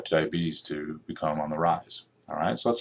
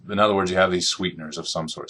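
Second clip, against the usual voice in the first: ordinary delivery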